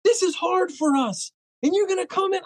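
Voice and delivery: high, shrill-edged imitation of an outraged boss; hammed-up offended owner; mock angry boss. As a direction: mimic her indignant scolding, high and slowed. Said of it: "she was like".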